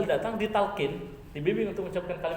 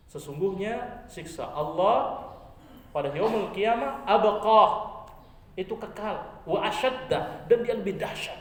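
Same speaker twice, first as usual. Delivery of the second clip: loud slow fiery warning; slow, booming sermon climax; preaching at full force; 5 semitones higher, 5 dB louder, much slower than usual. Acted. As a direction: thunder the warning of hellfire.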